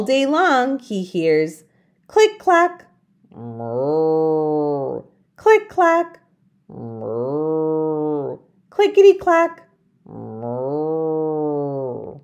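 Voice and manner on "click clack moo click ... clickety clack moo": singsong typewriter and cow; click clack moo. Acted. Typing sound and cow moo.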